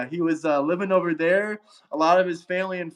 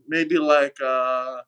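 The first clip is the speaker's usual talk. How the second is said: ordinary talk, nothing marked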